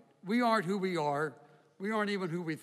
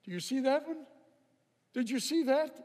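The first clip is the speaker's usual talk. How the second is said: higher, eager voice pointing at something unseen; dying person's quote; 8 semitones higher than usual. Retold or imitated. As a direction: voice a dying patient's wonder, pitched up. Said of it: "heard those who are dying saying to me".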